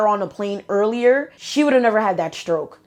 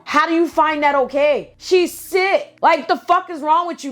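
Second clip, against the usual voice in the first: higher, outraged questions; heated outburst; 6 semitones higher than usual. Real, not acted. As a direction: let it fly, appalled. Going off at cousin.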